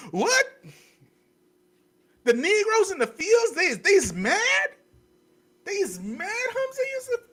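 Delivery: hammed-up disbelief; mock surprise. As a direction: play a shocked master.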